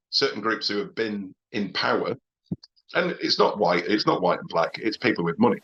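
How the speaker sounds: ordinary delivery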